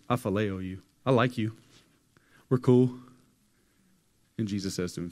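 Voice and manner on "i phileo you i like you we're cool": offhand Peter. Retold or imitated. Peter brushing off Jesus's question, breezy and loud. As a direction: shrug it off, getting louder. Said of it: "Peter says".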